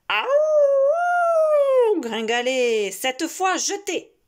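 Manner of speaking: high, loud wolf howl. Acted. Wolf howls while chasing.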